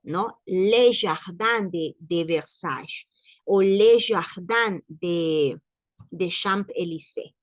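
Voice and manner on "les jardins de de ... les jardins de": slow, careful French "Les jardins de Versailles"; French pronunciation. Shown as a demonstration. Teacher modelling French for students, slow and careful. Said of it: "no le ponen, eh, de jardin".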